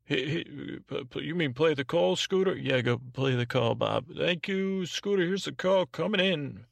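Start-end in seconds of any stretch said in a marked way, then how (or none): 0.1-2.6 high, stammering put-on sidekick; higher voice, questioning the host; sidekick Bob. Host voices Bob asking back. 4.2-6.6 high-pitched put-on sidekick voice; high, sing-out call screener; sidekick Bob. Host voices Bob introducing call.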